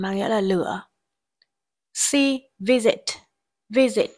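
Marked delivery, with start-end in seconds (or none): 2.6-4.1 visit with its vowel read as a short "i"; short I sound. Shown as a demonstration. Teacher modelling pronunciation for students, short I in visit. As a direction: say "visit" loudly with a clear "ih" vowel. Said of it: "Y được đọc là".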